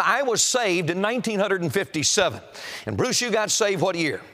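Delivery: normal talking voice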